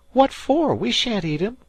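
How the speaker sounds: put-on high, loud boy's voice, insistent; he swears the dead man came back; spooked fisher boy. A narrator's reading. Dan to Harvey, spooked high boy voice. Dan asks about fish.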